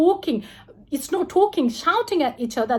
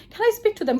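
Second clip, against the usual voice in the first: eager, slightly higher pleading; excited request to see family; happy eager asking; 6 semitones higher, much faster than usual. Retold or imitated. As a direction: beg happily to speak to family. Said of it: "I got so happy".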